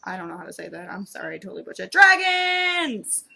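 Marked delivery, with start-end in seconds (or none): 1.9-3.2 high, loud cheer; gleeful high shout, falling at the end; excited shout. Real, not acted. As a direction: cry it out like a delighted fan. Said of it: "So excited about more dragons".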